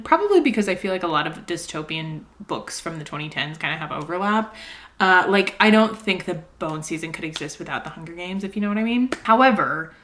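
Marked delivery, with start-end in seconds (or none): none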